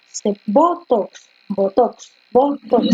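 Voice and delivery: slow "botox", stress on BO; teacher tries out "botox" slowly, stressing bo; sounding out the word. Shown as a demonstration. Teacher testing where "botox" carries stress, slow. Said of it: "Se puso botox".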